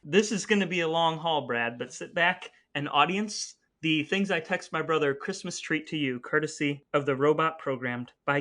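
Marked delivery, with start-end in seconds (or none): none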